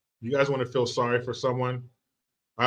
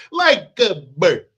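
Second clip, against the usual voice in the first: slow, louder bad Italian accent; Steven Seagal; 3 semitones higher, 6 dB louder, slower than usual. Comic repeating the favorite line, slow Italian accent. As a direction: stretch each word in a hammy Italian accent. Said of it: "do that Italian accent".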